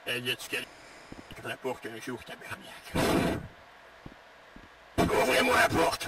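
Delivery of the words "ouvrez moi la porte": loud, higher demanding shout; adult voice shouting. Pretend. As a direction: bang on the door and yell. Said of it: "tu fais tellement bien la voix d'un adulte".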